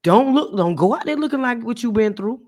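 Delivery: a mother cautioning her daughter; mother's advice. Retold or imitated. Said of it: "My mom always told me".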